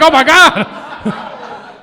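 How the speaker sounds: high, slow, laughing oh my god; amazed laughing. Retold or imitated. Date's friend cracking up, slow and high. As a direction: stretch it out in delight. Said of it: "He's like".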